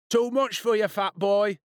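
high, loud schoolyard jeer; taunting. A narrator's reading. Bully mocks the boy squeezing past, taunting. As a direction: jeer at him, pitched well up. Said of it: "Gavin Hurst taunted".

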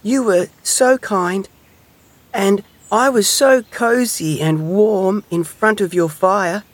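high, slow, dreamy little hedgehog; fond sigh. A narrator's reading. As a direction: recall a kindness gratefully, unhurried. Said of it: "sighed Little Hedgehog".

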